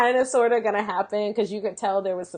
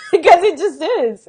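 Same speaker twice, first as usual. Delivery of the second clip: laughing; 6 semitones higher, 6 dB louder than usual. Real, not acted. Laughing about the messy show.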